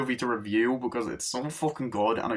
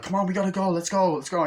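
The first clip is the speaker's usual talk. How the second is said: higher-pitched hurrying-them-along voice; acted urgency; 7 semitones higher, 3 dB louder, faster than usual. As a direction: imitate rallying people to run. Acts out character rushing others.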